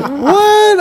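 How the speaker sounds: high, stunned what; shocked. Retold or imitated. Retold shocked reaction.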